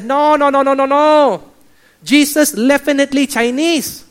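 high, loud mock Chinese accent; Chinese pastor. Chinese pastor refusing to be outdone, loud mock accent. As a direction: protest loudly in an exaggerated Chinese accent. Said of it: "the Chinese pastor doesn't want to be undone".

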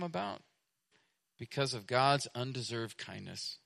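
soft, low, measured sermon voice; quiet, low-pitched reverence from the pulpit; hushed preaching. Real, not acted. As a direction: bring it right down, with pauses between phrases. Preacher drops to hushed exposition.

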